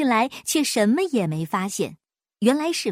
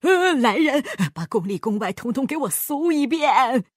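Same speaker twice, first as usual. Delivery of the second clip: shouting order; 3 semitones higher than usual. Acted. Shaken king shouts for a palace search. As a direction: bellow at the guards to search. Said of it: "大喊".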